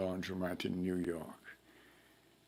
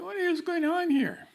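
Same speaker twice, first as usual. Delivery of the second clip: much higher, louder, incredulous outburst; baffled exclamation; 5 dB louder than usual. Retold or imitated. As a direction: throw it out in disbelief at yet another accent. Puzzled caller hears a new accent.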